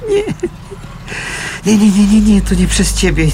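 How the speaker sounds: loud, high insistent denial; loud rushed comforting; urgent reassurance. Acted. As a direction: stop her blaming herself.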